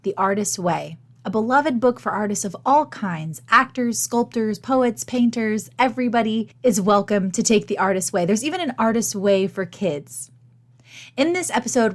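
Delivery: ordinary talk, nothing marked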